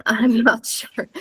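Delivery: whispered aside, half embarrassed. Real, not acted. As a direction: drop to a sheepish whisper. Unsure about Yoruba, laughing aside.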